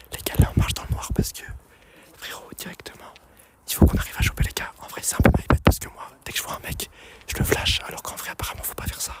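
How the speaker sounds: steady hushed whisper. Real, not acted. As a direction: keep it to a breathy whisper. Sneaking around, hiding from players.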